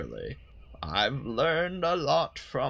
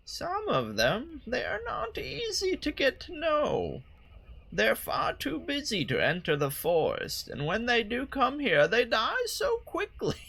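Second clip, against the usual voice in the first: put-on old lady voice; 10 semitones higher than usual. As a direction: play her as a frail old lady. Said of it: "do an old lady voice for her".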